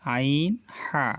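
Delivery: slow আইন and হা from mid-throat; drawn-out throaty আইন, হা; throat letters. Shown as a demonstration. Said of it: "কণ্ঠনালীর মাঝখান থেকে উচ্চারিত হয়".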